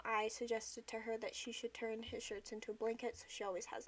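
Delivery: ordinary talk, nothing marked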